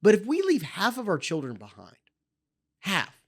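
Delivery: usual speaking voice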